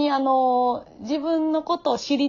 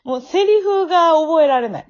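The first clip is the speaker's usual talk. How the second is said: laughing; 6 semitones higher, 6 dB louder than usual. Real, not acted. Laughing at own struggles.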